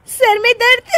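high echo breaking into laughter; laughing question. Acted. Bride to husband with a headache, laughing. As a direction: echo him and crack up. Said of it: "इसमें हंसने की क्या बात है?".

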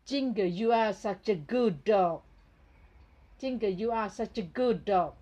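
very slow, loud, each word spelled out; loud and slow, like reading to a toy; slow clear English. Shown as a demonstration. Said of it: "ต้องพูด ภาษาให้ชัดนะคะ".

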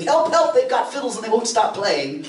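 panicked comic yelling under the music; mock screaming for help. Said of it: "before we could go out screaming".

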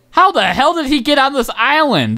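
high, startled question; can't believe what you're seeing; shocked disbelief. Real, not acted. Player spotting an Enderman on his island, startled.

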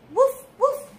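high, playful puppy woofs; yappy little dog voice; puppy barks. Acted. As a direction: bark like a small happy puppy. Storyteller voices the puppy Biscuit.

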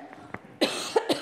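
dry, breathy cough mid-lecture; real cough. Real, not acted. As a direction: clear the throat with a hard cough. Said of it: "Excuse me for coughing".

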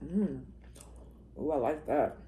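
food in mouth; savoring mid-bite; talking while chewing. Real, not acted. Seen 4: Bite taken just before; jaw working, lips pursed while speaking.

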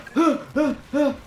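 sharp high gasp of fear; frightened gasp. Real, not acted. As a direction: gasp in panic. Gasp during chase.

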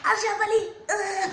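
high loud alarmed yelp; alarmed cry. Real, not acted. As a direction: blurt it out in alarm. Swallowed the disgusting mix.

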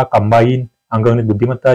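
normal talking voice

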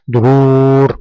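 دهور with the ū held long and level; long drawn-out vowel. Shown as a demonstration. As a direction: stretch the و in دهور for the pupil to copy. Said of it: "انطق معي". (مدّ الواو طويلًا في «دهور»)

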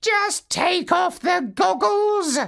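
a calm, high parrot giving advice. Acted. Parrot tells Marlowe to remove goggles, high bird voice. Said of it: "said the parrot".

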